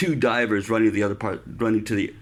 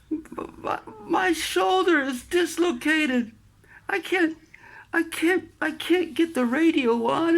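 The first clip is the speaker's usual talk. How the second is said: higher, strained stammering plea; pained and panicky. Retold or imitated. Sailor telling chief his shoulder is dislocated, pained. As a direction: stumble over it, hurting and panicked. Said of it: "I said".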